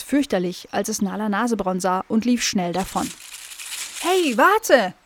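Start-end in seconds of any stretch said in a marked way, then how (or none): 4.0-4.9 put-on high, loud young dog voice; little dog shouting wait; dog calling after. A narrator's reading. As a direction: call out after the fleeing animal. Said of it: "rief Nala Nasebraun ihm hinterher".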